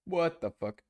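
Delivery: squeaky hiccup surprise; suppressing hiccups. Real, not acted. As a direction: caught by a hiccup. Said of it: "Sorry, I have hiccups".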